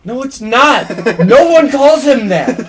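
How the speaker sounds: high, shouted denial through laughter; loud protest. Real, not acted. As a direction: shout it down, half laughing, dragging it out. Protesting a joke nickname for dad.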